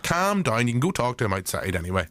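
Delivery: normal talking voice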